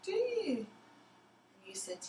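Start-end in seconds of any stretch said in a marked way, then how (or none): 0.0-0.7 "Oh, do you?" much higher and slow, in feigned surprise; mock surprise. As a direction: act amazed for the child, high and drawn out. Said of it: "And I said".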